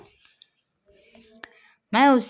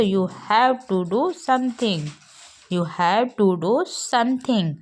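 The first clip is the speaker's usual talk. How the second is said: slow, clear English sentence; drilled sentence; slow teaching model; much slower than usual. Shown as a demonstration. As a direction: model it slowly for learners. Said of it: "तो कहते हैं".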